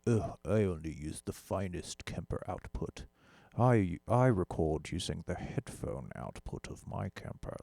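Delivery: mock a sniffy gear elitist with a drawn-out ugh; elitist gear snob. Mocking an online gear elitist to viewers, lightly snooty voice. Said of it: "not be an elitist asshole online and be like".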